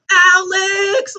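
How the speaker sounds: very high, loud drawn-out shout; mock screaming kid. Mocking Ellie calling out near danger, mock yell. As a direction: yell the name in hammed-up childish panic. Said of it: "you don't start going".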